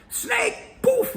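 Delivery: high, sudden mock jolt of fright; startled fear. Brain spotting a snake, high mock startle. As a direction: jump at it like you spotted a snake. Acting out instant fear reaction.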